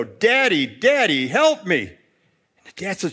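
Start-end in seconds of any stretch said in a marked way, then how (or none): 0.0-1.8 loud, pleading mock scream; acted cry for help; screaming child. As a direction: call out like a frightened little boy, half-screamed. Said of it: "his son is there screaming out the window".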